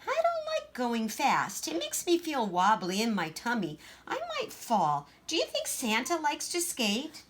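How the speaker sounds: put-on small, timid little girl voice; Gracie, shy child. Shy little Gracie asking her sister, timid.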